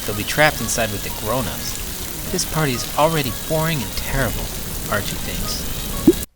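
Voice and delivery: bright, lively read-aloud over music; story narration. A narrator's reading. Host reading the picture book to kids, lively narration.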